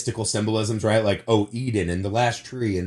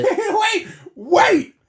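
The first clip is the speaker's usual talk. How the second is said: very high, laughing disbelief; stunned, laughing protest that shoots up in pitch; astonished interruption; 4 dB louder, much slower than usual. Real, not acted. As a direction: cut in with a squeal of surprise. Stunned by co-host's claim, interrupts.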